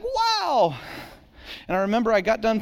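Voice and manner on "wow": high, lifted wow of disbelief; awed wow. Retold or imitated. Pastor remembering his girlfriend liking him, high awed wow. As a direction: marvel that she wants him.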